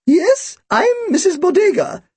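put-on thin, very high old-woman voice; elderly shopkeeper, thin and high; Missus Bodega. A narrator's reading. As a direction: introduce yourself in a birdlike high pitch. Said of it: "Her voice was thin and high-pitched".

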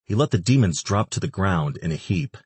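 ordinary delivery

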